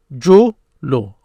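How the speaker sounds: "جلو" slow, split as ju, pause, lu; slow teaching demo of "جلو" with a gap; broken into syllables. Shown as a demonstration. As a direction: sound out "جلو" one syllable at a time. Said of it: "they break it down".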